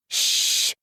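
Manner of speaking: long voiceless "shh"; hissed shush. A narrator's reading. Team silencing their coach, hissed shush. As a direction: shush sharply with breath alone. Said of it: "hissed the team".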